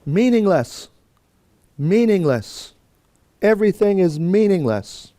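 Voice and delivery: high, loud proclamation of despair; crying out. Retold or imitated. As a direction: cry it out to the congregation like a preacher's opening line. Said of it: "he just cries out".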